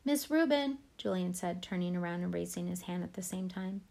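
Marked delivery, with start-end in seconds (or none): 0.0-0.8 louder, higher call across the room. Acted. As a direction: raise your hand and call the teacher. Julian calling out to teacher.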